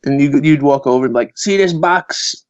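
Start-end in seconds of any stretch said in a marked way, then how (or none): 1.4-2.4 slow, pitched-up Brooklyn mobster giving orders; Brooklyn mafia guy. Retold or imitated. Mafia guy sending Irish kid on an errand, imitated Brooklyn mobster. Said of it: "all those mafia guys".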